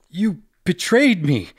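high furious shout through clenched teeth. Acted. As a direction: yell it at the traitor, jaw locked. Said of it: "Joe shouted through clenched teeth".

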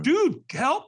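high, loud cry for help; desperate plea. Retold or imitated. Newly sober man begging his caller, desperate. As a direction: plead with a friend, frantic.

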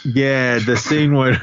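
ordinary delivery

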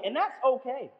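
usual speaking voice

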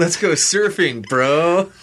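slow mock Southern California surfer drawl; Southern California accent. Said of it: "Southern California accent coming through".

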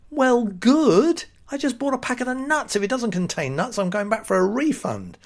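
high-pitched comic complaint; mock indignant. Customer objecting to the packet's warning, mock indignant.

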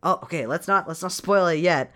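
ordinary talk, nothing marked

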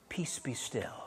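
very quiet, low command; calm the storm almost under your breath; really soft. Acted. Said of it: "I think he was really soft".

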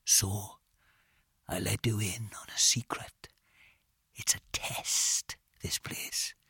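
slow conspiratorial whisper; soft, breath-heavy confiding; barely above a whisper. A narrator's reading. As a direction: let them in on a secret, hushed. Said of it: "He dropped his voice to not much above a whisper".

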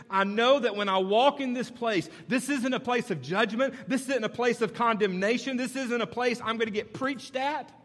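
rising pulpit intensity; preaching at full force. Acted. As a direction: build to a fervent declaration. Preaching builds up.

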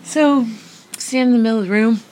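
high, loud, rushed burst of excitement; excited. Retold or imitated. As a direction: blurt it out, giddy and fast. Repeats the line, much higher.

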